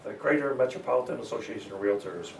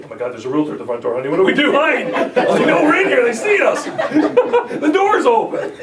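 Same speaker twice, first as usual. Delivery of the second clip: high, loud, laughing mock panic of a scared homeowner; hammed-up frightened couple hiding from the door; 10 semitones higher, 12 dB louder than usual. Seller couple spotting a realtor at the door, loud laughing mock panic.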